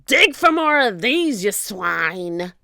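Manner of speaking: lightly voiced pirate captain. A narrator's reading. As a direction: order the pirates around. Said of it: "he said".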